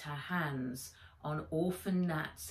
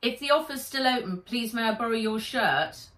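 Charlotte asks; 5 semitones higher, 6 dB louder than usual. Acted.

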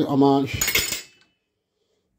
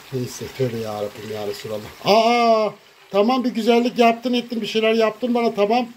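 usual speaking voice

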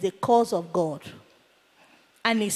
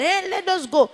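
urgent call; 6 semitones higher than usual. Retold or imitated. Impatient onlookers urging others to leave.

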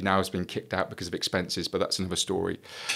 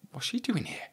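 hushed whisper of disbelief; 13 semitones higher, 8 dB quieter than usual. Retold or imitated. Recalling seeing his boss at dinner, whispered. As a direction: whisper it so nobody hears.

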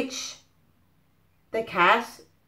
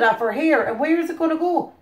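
ordinary delivery, nothing marked

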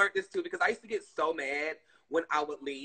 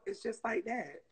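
ordinary talk, nothing marked

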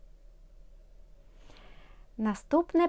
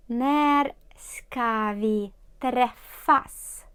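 very slow model; much slower than usual. Shown as a demonstration.

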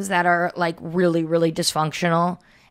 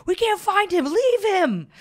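much higher, theatrical false worry; fake concern; 14 semitones higher, slower than usual. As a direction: play it falsely worried. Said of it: "we'd be like".

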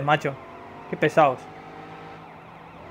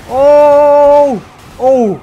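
high, loud "Oh!" cries; loud surprised shouts; 9 semitones higher, 12 dB louder than usual. Real, not acted. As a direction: shout in shock. Streamer reacts to crash. (gritos de sorpresa)

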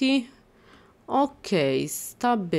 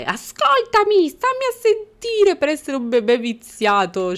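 high, loud scolding; mock scolding; 7 semitones higher, 5 dB louder than usual. Real, not acted. Gamer scolding a spoiled baby, loud and high.

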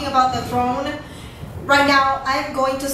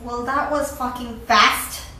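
normal talking voice

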